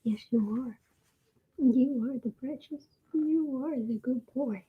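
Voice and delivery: soft cooing pet talk. Real, not acted. Cooing to the cat.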